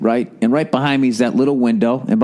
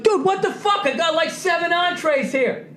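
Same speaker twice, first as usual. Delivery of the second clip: shrill high mock shout that drops softer; fed-up cook outburst; 3 dB quieter than usual. Busy chef snapping at the narrator over a burger, high mock shout. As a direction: imitate a swamped cook snapping, pitched way up. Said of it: "He's like".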